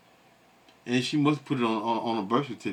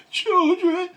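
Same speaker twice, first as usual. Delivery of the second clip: a much higher, louder wail on one word; mock crying; 4 dB louder than usual. Host mimicking the crying woman in the clip, mock tearful wail. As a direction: mimic her tearful voice, hammed up. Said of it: "she crying".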